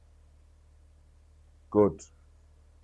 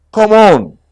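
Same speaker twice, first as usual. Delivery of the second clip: eager call; 8 semitones higher, 8 dB louder than usual. Acted. Pooh urging Piglet.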